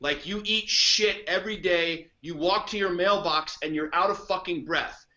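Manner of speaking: usual speaking voice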